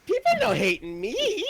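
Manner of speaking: very high broken-English alien voice; squeaky silly Gungan voice; Jar Jar voice. Retold or imitated. Jar Jar impression.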